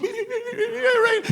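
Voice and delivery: very high mock squeal; tiny high-pitched babble; imitated excited squeal. Imitating woman's reaction to him.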